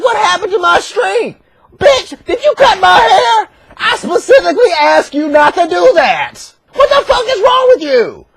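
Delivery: high-pitched indignant squawk; mock outrage, voice shooting up high; shocked outrage. Samson confronts Delilah over his cut hair, high mock outrage.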